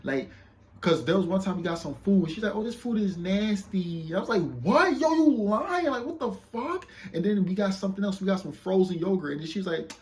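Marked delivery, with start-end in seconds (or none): 2.6-4.1 high, drawn-out mimicked complaint; mock bougie. Woman complaining about the food, mock bougie. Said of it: "You being bougie". 4.6-6.9 very high, loud mock disbelief; incredulous outburst. Man retelling his answer to the food complaint, incredulous. Retold disbelief at her lie.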